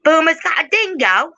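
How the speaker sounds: high-pitched, badly faked Australian; attempted Australian accent. Host practising an Aussie line about a dingo, high mock Australian. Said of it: "I can't do Australian accent either".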